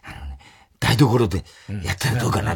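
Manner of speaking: low, halting retold confession; imitated Matsuoka confession. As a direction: drop low and confide it haltingly, imitating him. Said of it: "実は松岡が". (低い声でぼそぼそ打ち明ける物真似)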